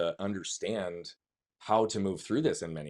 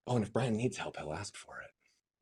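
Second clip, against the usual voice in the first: soft, slowing ironic aside; fading aside; 8 dB quieter, slower than usual. Retold or imitated. The world's unspoken narrative, softly trailing off. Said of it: "the narrative the world added".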